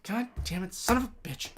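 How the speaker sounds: very quiet grumbled swearing; swearing under breath. Retold or imitated. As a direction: curse softly to yourself, exasperated. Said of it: "I'm just swearing under my breath".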